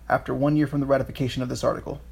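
rattled off quickly; fast reading. Real, not acted. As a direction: read the quote at speed. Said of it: "it's section one".

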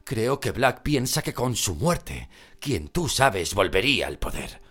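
tense hushed urgency; worried urgent. Acted. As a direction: press the point urgently. Mr. Weasley arguing with Molly.

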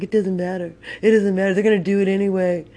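high put-on mocking voice; amused, dismissive heckler. Laughing bystander dismisses the honking rider, high mocking voice. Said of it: "he's laughing".

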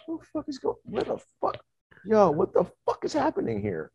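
high-pitched freaked-out disbelief; bewildered alarm. Retold or imitated. Looking at himself as an ape, high bewildered alarm. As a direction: squeal it in total confusion.

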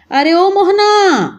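high, slow, drawn-out call from afar; mother calling out. Acted. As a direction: call your son home across the fields. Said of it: "تمہاری ماں پکار رہی ہے". (ماں کا دور سے اونچی لمبی آواز میں پکارنا)

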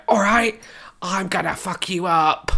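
mock tough-girl British accent, slowing at the end; exaggerated British. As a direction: play an American hamming up a British accent. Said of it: "Be really British".

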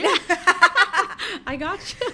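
laughing while talking; words through a laugh. Real, not acted. Laughing at sponsor joke.

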